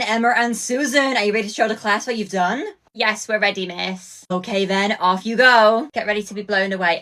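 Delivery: usual speaking voice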